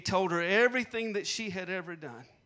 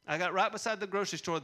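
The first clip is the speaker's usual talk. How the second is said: ordinary talk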